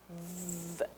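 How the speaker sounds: "V" as a long buzzing consonant, no vowel; vibrating V sound. Shown as a demonstration. Teacher shows pupils the letter's sound, vibrating V. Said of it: "Ó, vibrou".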